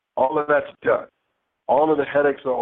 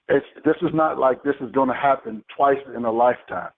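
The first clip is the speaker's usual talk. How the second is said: ordinary delivery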